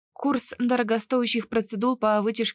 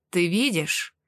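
understated satisfaction in the question; mildly gratified; pleased, amused; 3 semitones lower, slower than usual. A narrator's reading. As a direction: ask with a slight self-satisfied smirk. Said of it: "довольно усмехнулся Кристен".

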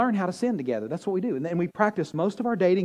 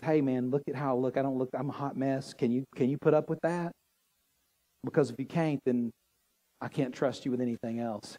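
soft pleading woman; 5 semitones lower, 6 dB quieter than usual. Acted. Woman's voiced plea in sermon.

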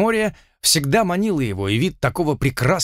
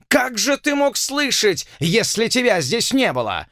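angry colonel; 8 semitones higher than usual. Acted.

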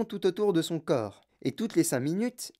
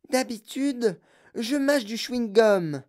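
put-on bratty girl voice; 5 semitones higher, slower than usual. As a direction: shout it like a bratty kid. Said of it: "hurla-t-elle".

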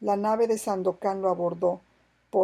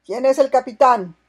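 loud demand; 5 semitones higher, 12 dB louder than usual. A narrator's reading. Pirate demands captain after boarding.

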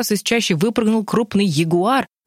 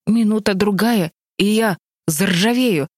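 higher, slow sad lament; 4 semitones higher than usual. A narrator's reading. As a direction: sigh it out sadly. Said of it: "грустно сказал Дровосек".

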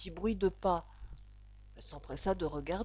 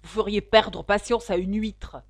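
raised-voice tart complaint; sour; 8 dB louder than usual. A narrator's reading. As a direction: complain tartly to a parent. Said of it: "d'un ton aigre". (reproche d'un ton aigre)

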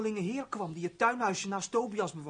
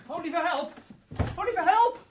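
calling out in alarm, rising higher; cry for help; 7 semitones higher than usual. Acted. Servant runs in crying that the count fell in, alarmed. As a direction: raise the alarm with a high call for help.

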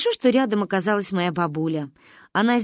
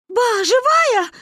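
high, loud, drawn-out question; tearful child's shout; 6 dB louder, much slower than usual. A narrator's reading. Manya asks if Ba's alive, high tearful shout. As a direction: cry it out hopefully through snot and tears. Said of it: "крикнула, размазывая сопли по лицу".

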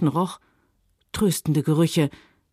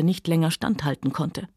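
plain reading voice

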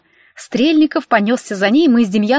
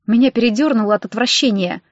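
plain reading voice

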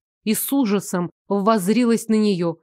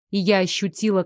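plain reading voice

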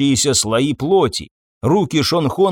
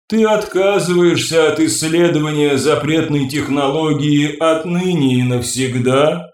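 ringing crystal chime voice; 3 semitones higher than usual. Acted. The Sella figure questions.

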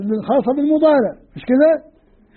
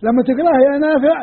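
ordinary talk

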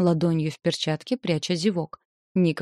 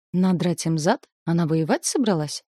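ordinary delivery, nothing marked

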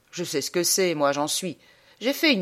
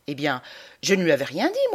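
ordinary talk, nothing marked

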